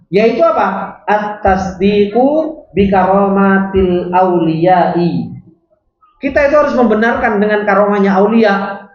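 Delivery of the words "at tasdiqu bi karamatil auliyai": each Arabic word drawn out very slowly; slow dictation. Shown as a demonstration. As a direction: read it word by word for students to follow. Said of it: "teacher dictating the Arabic text".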